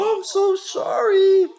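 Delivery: high, drawn-out fake sob; mock crying. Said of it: "crying and going".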